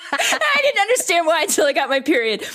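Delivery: high, giggly punchline; laughing hard. Real, not acted. Host admitting her period explained it, laughing. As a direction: get it out through laughter.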